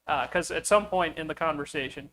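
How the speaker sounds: ordinary delivery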